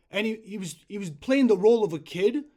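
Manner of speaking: ordinary talk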